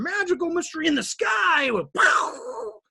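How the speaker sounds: high, shouted pow; mock grand exclamation. Real, not acted. Speaker mocks the magical sky creation with a shouted pow. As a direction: throw the word out like a burst of magic.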